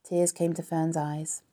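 ordinary delivery

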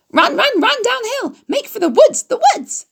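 very high, loud honking shout. A narrator's reading. Goose shouting to Wilbur to run downhill, very high and loud. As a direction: honk a frantic warning. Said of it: "she shouted to Wilbur".

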